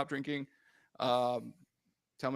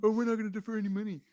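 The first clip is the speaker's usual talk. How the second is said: mocking quote; 3 semitones higher, much faster than usual. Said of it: "don't come in here with the".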